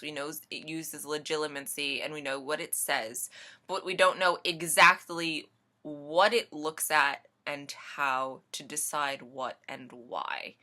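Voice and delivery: usual speaking voice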